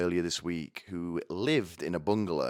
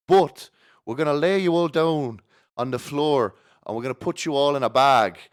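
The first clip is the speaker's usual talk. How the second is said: higher, louder, emphatic sales pitch; mock airline pitch; 7 semitones higher, 7 dB louder than usual. Comic laying out an absurd airline plan to the audience, mock sales pitch. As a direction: pitch it brashly, as if announcing a grand scheme.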